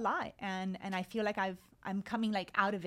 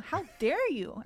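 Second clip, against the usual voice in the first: mock indignant; 5 semitones higher, 6 dB louder, slower than usual. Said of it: "the really angry Marley".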